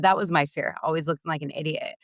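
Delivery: usual speaking voice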